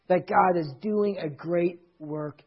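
slow, low appeal fading nearly to nothing; hushed solemn preaching. Real, not acted. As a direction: let each word sink in, very softly.

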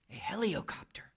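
put-on whispered like a small child; little voice. Child tells the boss about the noise, in a little whispered voice. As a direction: whisper it, tiny and secretive. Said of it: "said the little voice".

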